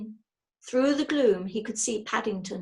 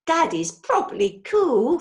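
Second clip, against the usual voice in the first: put-on higher and louder boyish admiration; a son in awe of his dad; admiring boy; 6 semitones higher, 7 dB louder than usual. A narrator's reading. Son praising brave dad, excited boyish voice. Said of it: "said Jonathan".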